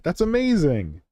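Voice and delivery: normal talking voice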